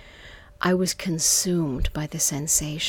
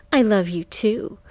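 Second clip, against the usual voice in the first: raised, mocking mimicry; 4 semitones higher, 5 dB louder than usual. A narrator's reading. Johnny mimicking her words to Michael, mocking. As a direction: mimic it back tauntingly, rising at the end. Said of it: "in a mocking voice".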